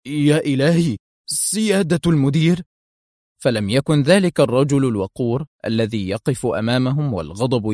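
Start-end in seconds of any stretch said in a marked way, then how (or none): 0.1-2.6 higher, alarmed exclamation; a touch of shocked dismay; dismayed murmur. A narrator's reading. Colleague paling at seeing the director, dismayed. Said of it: "شحب وجه قدري وهو يغمغم".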